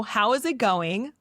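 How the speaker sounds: normal talking voice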